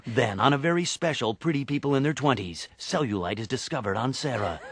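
portentous promo read, dropping at the end; TV promo announcer. Acted. Comedian spoofing TV promos, announcer voice. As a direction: tease the episode like an announcer. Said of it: "Like in promos".

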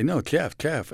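put-on No, Jeff with a childhood lisp. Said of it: "I grew up with a lisp".

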